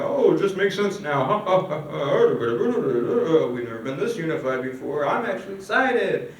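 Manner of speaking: lightly voiced cheerful office chatter; mock upbeat group chatter with a laugh; chattering employees. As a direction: act out a hint of contented coworker babble. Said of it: "you can hear all the employees".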